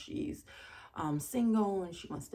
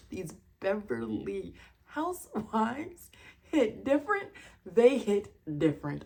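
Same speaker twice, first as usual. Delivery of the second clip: loud, animated hammering of the point; emphatic amazement; 7 dB louder than usual. Real, not acted. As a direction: declare it forcefully, thoroughly amazed. Emphatic amazed verdict on Housewives.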